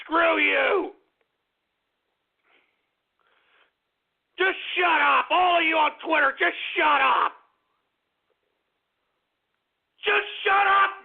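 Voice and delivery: high, forceful, exasperated shout; angry yelling. Real, not acted. Host telling Twitter users off, angry yelling. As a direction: yell it at them to silence them.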